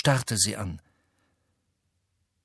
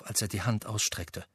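ordinary delivery

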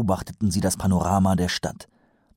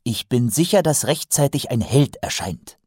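a higher-pitched, lightly voiced keen recruit; earnest young watchman, confident; 7 semitones higher than usual. A narrator's reading. Recruit assures colleagues a hero will appear, earnest confidence. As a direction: assert it with naive conviction.

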